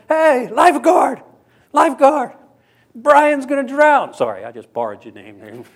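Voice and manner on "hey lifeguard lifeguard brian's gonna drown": high, loud panicked call from the beach; panicked yell. Retold or imitated. Panicked buddy shouting at the lifeguard, high and loud. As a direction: yell for the lifeguard, much higher and louder.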